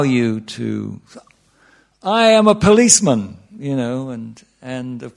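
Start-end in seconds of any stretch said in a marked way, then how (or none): 2.0-3.4 louder, higher self-introduction; proud declaration. Acted. Newcomer answering who am I, loud proud declaration. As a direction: announce it loudly and high.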